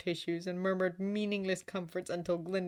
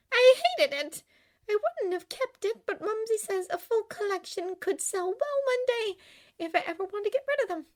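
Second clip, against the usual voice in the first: put-on high-pitched young woman, emphatic at first; high, girlish chatter; girlish Glinda, peeved; 5 dB louder than usual. A narrator's reading. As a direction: complain in a high character voice. Glinda's character voice.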